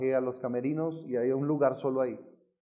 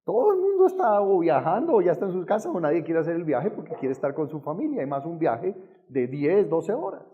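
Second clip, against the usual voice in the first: louder, rising objection to God; exasperated protest; 2 semitones higher, 4 dB louder than usual. Retold or imitated. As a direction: argue back in disbelief, piling up reasons.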